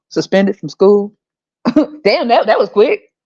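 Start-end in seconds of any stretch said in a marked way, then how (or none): none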